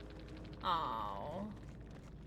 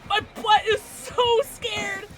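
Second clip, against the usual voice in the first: slow, drawn-out frightened words; mock terrified wail; stretched-out scared gasping whine; scared whimper; 4 semitones higher, slower than usual. Real, not acted. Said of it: "I'm so scared right now".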